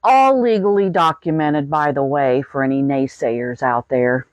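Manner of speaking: usual speaking voice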